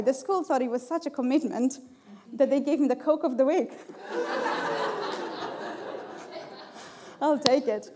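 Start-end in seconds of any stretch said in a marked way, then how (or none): 3.8-7.2 a long, breathy laugh; laugh, tickled by the award; airy laugh. Real, not acted. She laughs about her husband getting the school's Coke of the Week.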